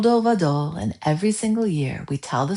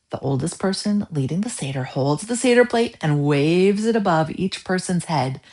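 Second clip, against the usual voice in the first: plain reading voice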